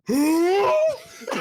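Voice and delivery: high, long-held "whooo"; imitated oblivious loud "who?" holler; very loud hoot. Homegirl told guys are checking her out, loud hooted "who". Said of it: "she's like the loudest".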